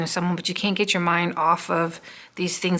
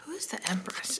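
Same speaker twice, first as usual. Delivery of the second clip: whisper; 3 semitones higher, 13 dB quieter than usual. Real, not acted. Asking the cards under her breath.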